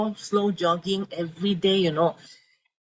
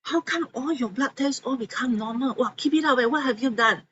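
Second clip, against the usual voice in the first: higher, amazed doctor's exclamation; shocked doctor; 6 semitones higher than usual. Retold or imitated. Renal doctor amazed at her normal blood tests, shocked. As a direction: gasp it out like a doctor who can't believe the results. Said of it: "very shocked and say that".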